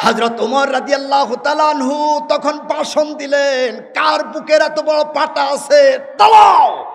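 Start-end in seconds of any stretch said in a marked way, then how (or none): 3.8-6.8 high, loud, defiant threat; acted challenge. Preacher acts Umar challenging his foes, fierce shout. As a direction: throw down a shouted dare.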